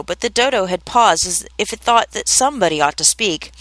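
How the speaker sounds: ordinary delivery, nothing marked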